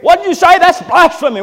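high, loud outraged scholars; shocked doctors. Retold or imitated. Doctors shouting at the boy Jesus, high and outraged. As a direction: cry out at the blasphemy.

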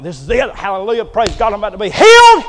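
rising to a loud, joyful high; excited. Acted. Naaman at the prophet's door, loudly excited about his healing. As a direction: build from hopeful to a shouted praise.

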